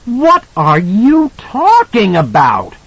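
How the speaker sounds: very high, drawn-out outburst; slow, strained high cry of outrage; near scream. A narrator's reading. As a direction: nearly scream it in disbelief. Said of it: "Wendy nearly screamed".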